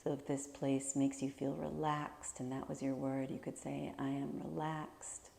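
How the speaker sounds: calm, measured meditation voice with long pauses; guided meditation. Real, not acted. Teacher leading listeners to imagine their favorite place, guided meditation. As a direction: guide them gently through a visualization, leaving space to breathe.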